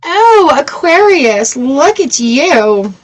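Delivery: high, slow, delighted coo at the card. Real, not acted. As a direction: gush over the card with delight.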